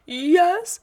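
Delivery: put-on high loud childish yes; insistent child. Son insists to mom it was on purpose, high childish yes. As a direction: insist like a stubborn kid. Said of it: "Do you really think".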